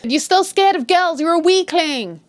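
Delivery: berating. Retold or imitated. Father scolding his sick son, mock berating. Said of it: "So he's still berating him".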